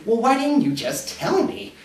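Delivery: complaining partner. Acted. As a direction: voice the partner's aggrieved protest. Partner's complaint in acted quarrel.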